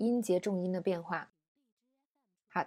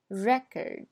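Record stressed on REC; noun stress; 4 dB louder than usual. Shown as a demonstration. Teacher repeats the noun, stress on REC. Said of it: "前面是名词".

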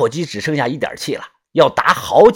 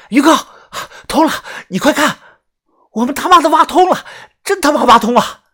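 excited shouting; 12 semitones higher, 5 dB louder, faster than usual. Acted. Breakthrough after digging all day.

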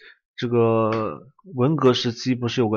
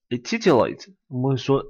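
usual speaking voice

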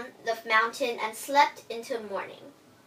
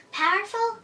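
much higher, astonished question; surprised; 9 semitones higher than usual. Acted. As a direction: gasp at the idea. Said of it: "said the wind".

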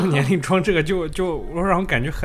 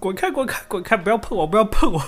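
higher, squeamish shooing off; disgusted; 7 semitones higher than usual. Retold or imitated. Host voicing disgust at eyelash cars, squeamish shoo. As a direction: push it away in mock disgust. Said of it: "就超嫌弃".

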